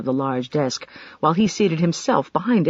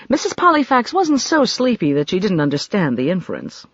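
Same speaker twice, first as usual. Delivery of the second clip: ordinary delivery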